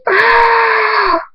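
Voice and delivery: long, very high scream; high sustained shriek of agony; scream of pain. A narrator's reading. Hunter as the axe hacks his wing, screaming.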